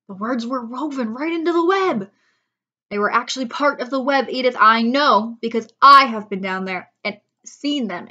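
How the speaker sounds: loud, eager farmer; excited farmer. Acted. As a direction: announce the miracle breathlessly. Zuckerman excited explanation.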